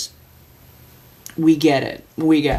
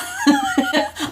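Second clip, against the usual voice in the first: high laugh. Real, not acted. Laugh after quote.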